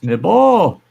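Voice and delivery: excited greeting. Real, not acted. Greeting a friend joining stream.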